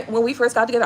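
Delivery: ordinary talk, nothing marked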